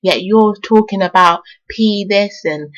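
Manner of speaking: normal talking voice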